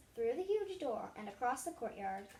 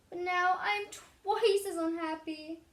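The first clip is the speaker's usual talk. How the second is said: high, very loud, slow wail; wailing king; 7 semitones higher, 11 dB louder, slower than usual. A narrator's reading. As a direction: howl it out even more miserably. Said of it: "he wailed".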